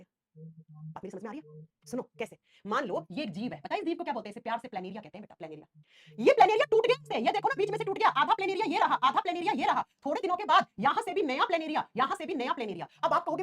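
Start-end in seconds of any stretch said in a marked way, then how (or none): 6.2-8.1 excited. Acted. Teacher exclaiming demo.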